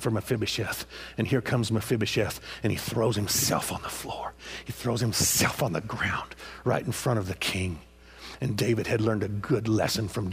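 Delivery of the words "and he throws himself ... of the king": whispered narration of the kneeling; hushed storytelling. Acted. Preacher hushing as Mephibosheth bows before the king.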